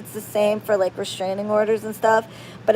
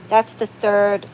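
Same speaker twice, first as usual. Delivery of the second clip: ordinary talk, nothing marked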